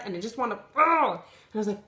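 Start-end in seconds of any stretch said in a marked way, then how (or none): 0.8-1.1 frustrated groan. Real, not acted. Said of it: "and I'm mad".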